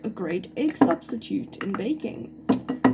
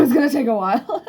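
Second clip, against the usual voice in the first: said through a laugh; loud amused laughing line; 4 semitones higher, 6 dB louder, faster than usual. Real, not acted. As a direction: laugh as you admit it. Slow filtering.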